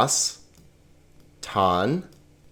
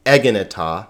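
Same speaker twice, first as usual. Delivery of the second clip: "egeneta" stressed on the opening "e"; misplaced first-syllable stress. Shown as a demonstration. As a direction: say E-gen-e-ta, weight on the first syllable.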